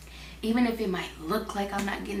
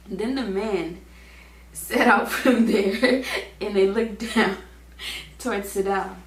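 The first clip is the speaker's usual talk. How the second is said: slow, louder, laughter breaking in; fighting the giggles; laughing while reading; 5 dB louder, slower than usual. Real, not acted. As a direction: try to read but keep laughing. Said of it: "Once I start laughing".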